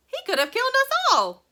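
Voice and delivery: high, loud shrieked complaint; screaming. A narrator's reading. Grandma Josephine screaming about Mr Wonka, high loud shriek. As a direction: shriek it out in outrage. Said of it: "screamed Grandma Josephine".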